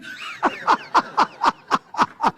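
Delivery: joyful laughing; delighted laugh. Real, not acted. Preacher laughs loudly.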